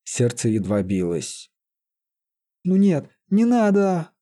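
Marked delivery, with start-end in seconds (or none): 2.6-4.1 slow, high-pitched plea, not whispered; pleading. A narrator's reading. Sorcerer over fading Лиза, slowly pleading. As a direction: draw out the begging. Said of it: "умоляюще прошептал он".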